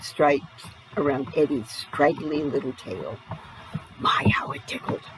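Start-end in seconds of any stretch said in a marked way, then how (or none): 4.0-5.0 whispered. A narrator's reading. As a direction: whisper it like a secret to the child. Narrator savours the tickle.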